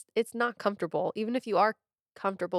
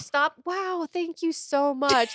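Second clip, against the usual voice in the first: high, loud, drawn-out fake gratitude; hammed-up flattered reply; mock gushing thanks; 9 semitones higher, 5 dB louder, slower than usual. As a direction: gush sarcastically, as if flattered. Acts fake reply to a catcaller.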